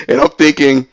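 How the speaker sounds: usual speaking voice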